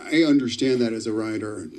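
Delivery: usual speaking voice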